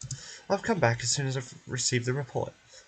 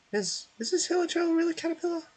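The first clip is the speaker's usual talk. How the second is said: much higher, bright little companion voice, bewildered; Paimon's voice. Acted. Paimon stunned that the Hilichurl is Caterpillar, high and confused. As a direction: squeak out her disbelief like a tiny sidekick.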